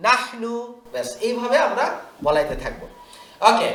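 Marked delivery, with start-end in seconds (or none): none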